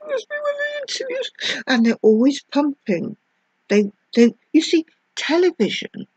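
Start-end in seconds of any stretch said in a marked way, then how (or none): 0.1-1.3 high, quick weepy voice; mock tearful interviewee. Mocking a TV interviewee, high and tearful. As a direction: play a teary guest on TV.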